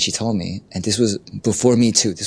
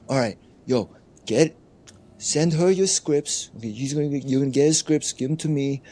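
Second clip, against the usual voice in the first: bossy producer voice; 6 semitones higher than usual. Retold or imitated. Retelling a producer bossing the host.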